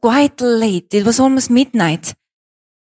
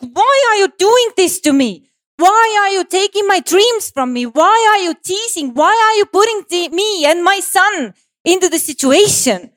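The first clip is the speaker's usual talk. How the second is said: high, pressing furious questions; angry at the universe; 10 semitones higher than usual. Retold or imitated. Yelling up at the universe about her lost dreams, high and angry. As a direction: rail at the universe, pitched high. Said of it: "I was like".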